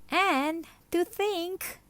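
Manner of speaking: high, slow, furious hiss; hissing lizard. Acted. The livid lizard hisses at Martina, high and slow. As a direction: seethe through it. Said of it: "he hissed".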